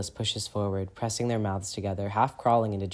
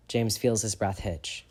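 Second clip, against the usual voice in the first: usual speaking voice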